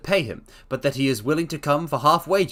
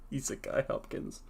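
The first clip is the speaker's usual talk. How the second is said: laughing at the slip; amused laugh; 6 semitones higher, 6 dB louder than usual. Real, not acted. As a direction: giggle through the name.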